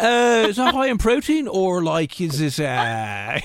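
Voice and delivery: mock the awkward picky guest; prissy dieter. Dieter refusing in-laws' food, high mock-fussy voice. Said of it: "come across as".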